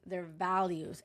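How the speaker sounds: ordinary talk, nothing marked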